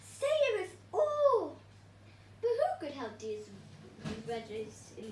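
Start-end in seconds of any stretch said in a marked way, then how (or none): 0.0-1.6 high, loud and slow plea; hammed-up plea for rescue; pleading cry. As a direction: beg the hero to come. Veggies crying for help.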